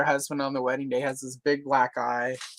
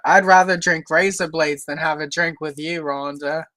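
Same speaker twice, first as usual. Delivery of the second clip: a light, louder Australian accent put on for a soap line; jokey Australian accent; 6 dB louder than usual. Joking with a friend, quoting a soap in a light Australian accent. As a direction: ham up a soap opera insult in an Australian accent. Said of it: "the accent is trying to come through 'cause I jokingly did".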